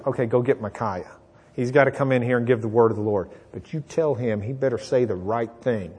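low, soft instruction to an underling; confidential order. Acted. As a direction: give a quiet private warning, voice dropped. Ahab privately instructs messengers outside room.

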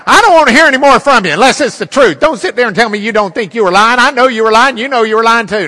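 furious dressing-down from the bench, rising to a yell; furious judge scolding a lying expert witness. Retold or imitated.

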